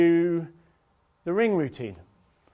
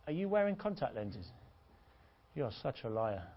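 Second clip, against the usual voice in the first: close-up murmur; 2 semitones lower, 9 dB quieter than usual. Acted. Coach leans right into her, softly accusing. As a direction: say it softly, as if leaning in. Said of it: "lean right into her and go".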